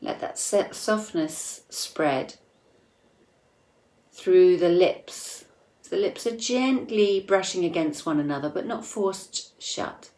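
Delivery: soft, unhurried meditation voice with long pauses; guided meditation. Acted. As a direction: guide listeners inward gently, leaving space between phrases.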